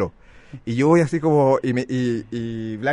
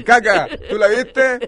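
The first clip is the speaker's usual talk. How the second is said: higher, louder slow snobby question; posh mock question; 9 semitones higher, 6 dB louder, slower than usual. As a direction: drawl the nickname grandly. Apodo pijo fingido. (pregunta pija de burla, aguda, fuerte y lenta)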